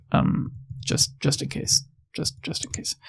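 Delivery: dropping to a whisper. Real, not acted. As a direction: trail off into a hush. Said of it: "trailing whisper".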